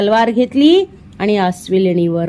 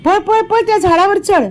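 high, urgent yell, climbing higher; shouting a warning; 9 semitones higher than usual. A narrator's reading. Vihari warns Vijay to flee the bear: urgent high shout. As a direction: shout to a friend to run and climb. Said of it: "Vihari ओरडला".

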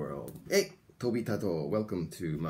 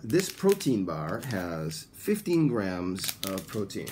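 slow, evenly spaced English for learners to repeat; teacher's deliberate listen-and-repeat delivery; slow model sentence; slower than usual. Shown as a demonstration. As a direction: enunciate each phrase carefully, like a language teacher. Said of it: "Please listen and repeat".

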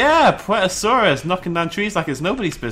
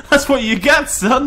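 much higher, louder, laughing taunt; laughing gloat; 9 semitones higher, 5 dB louder than usual. Real, not acted. Gloating at the fleeing T-Rex, a high laughing taunt. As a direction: laugh it in his face.

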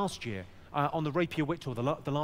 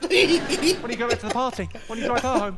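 put-on high, loud jealous girlfriend rant; mock jealous outburst from the passenger seat; 5 semitones higher, 7 dB louder than usual. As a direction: throw the accusation at him, shrill and loud.

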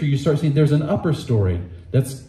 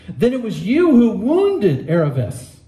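put-on boy's accusation; 6 semitones higher than usual. Shasta speaking to Aslan.

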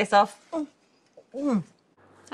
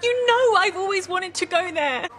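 indignant protest; 7 semitones higher than usual. Acted. Upset over the cancelled holiday.

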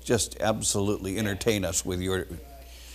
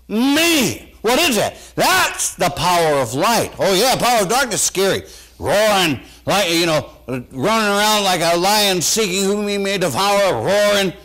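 loud, high excited preaching; 7 semitones higher, 4 dB louder than usual. Acted. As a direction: shout it out to the congregation. Preaching at full force.

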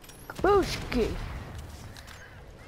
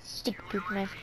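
whispered under the breath; a quick whispered insult; 5 semitones lower, 7 dB quieter than usual. Real, not acted. Gamer grumbling at the enemy player, whispered. Muttering after being attacked.

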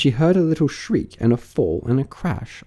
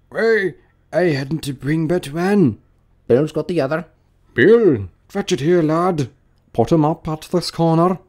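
higher-pitched rustic workman's voice; busy workman; 7 semitones higher, 3 dB louder than usual. Acted. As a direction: call instructions across to your mates. Workmen shout instructions to each other.

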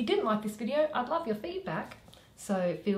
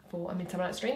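ordinary delivery

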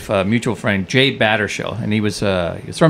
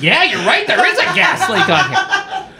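stewardess. Retold or imitated. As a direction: imitate a stewardess agreeing, voice pushed high. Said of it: "then she goes".

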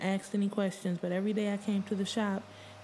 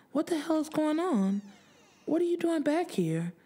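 startled demand; 9 semitones higher than usual. Acted. Startled at 3 a.m. visitor.

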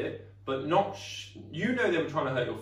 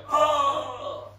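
mock hurt oh; 11 semitones higher, 5 dB louder than usual. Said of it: "I was like".

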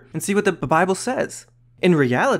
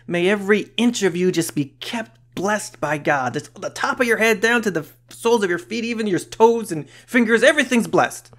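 lifted, higher-pitched retold blessing; enthusiastic blessing; 7 semitones higher than usual. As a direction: build upward as the blessing covers everything. Enthusiastic paraphrase of Paul's blessing.

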